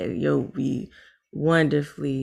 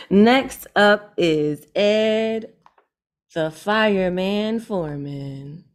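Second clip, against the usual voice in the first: announcer call; 4 semitones higher, 4 dB louder, slower than usual. Acted. Host announcing next poet.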